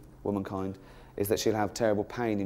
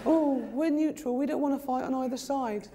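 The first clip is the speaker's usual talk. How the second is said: mock wimpy Swiss; 13 semitones higher than usual. Comic mocking Swiss refusing to fight, high mock-wimpy voice. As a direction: plead neutrality in a silly high voice.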